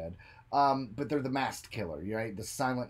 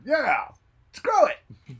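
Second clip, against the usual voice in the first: high, loud goofy cheer; hammed-up high yell; mock townsfolk cheer; 13 semitones higher, 11 dB louder, much slower than usual. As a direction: squeal it like a giddy local. Mocking town cheer.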